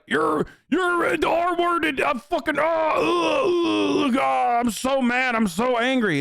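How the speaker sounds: hammed-up, high, shouted rage with mock sobs; mock furious viewer. Streamer mocking an angry viewer who insulted him, hammed-up screaming rage. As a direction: play the triggered viewer, screaming and near tears. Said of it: "So he's like".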